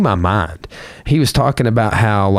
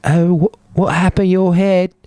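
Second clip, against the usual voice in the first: high, sing-song concerned mother; mom voice; 11 semitones higher than usual. Retold or imitated. Retelling a viral video, voicing the concerned mom. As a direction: coo like a worried mom. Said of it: "And her mom's like".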